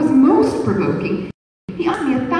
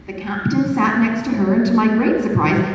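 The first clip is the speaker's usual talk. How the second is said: plain reading voice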